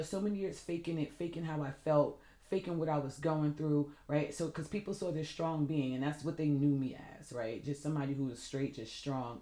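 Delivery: ordinary talk, nothing marked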